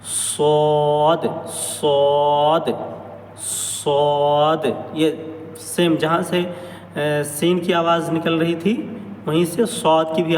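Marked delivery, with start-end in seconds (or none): 0.0-4.7 صاد drawn out with a heavy, full sound; thick sad demo. Shown as a demonstration. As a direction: make صاد thick and hold it. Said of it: "صاد کی آواز موٹی ہوگی".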